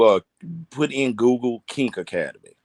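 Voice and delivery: ordinary delivery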